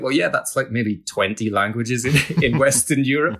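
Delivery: mock-patient reply that breaks into laughter; laughing retort. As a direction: laugh through the comeback to the critics. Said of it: "And you kind of think".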